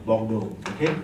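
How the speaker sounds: normal talking voice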